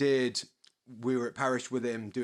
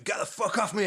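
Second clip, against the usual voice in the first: mock shout; 9 semitones higher than usual. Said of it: "really shouting".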